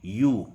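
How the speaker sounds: "यू" as one long syllable, like you; correct pronunciation. Shown as a demonstration. Teacher answering her own question on ewe, clearly pronounced "यू". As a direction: say "यू" just like the word you. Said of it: "इसका pronunciation क्या होगा".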